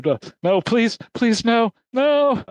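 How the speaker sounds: high, hammed-up captive begging for mercy; frightened pleading. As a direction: beg in a small, squeaky mock panic. Captives beg not to be killed.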